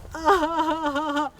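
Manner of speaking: high fake sob trailing off; a last mock sob; hammed-up sob. Wife finishing her lament with a high mock sob.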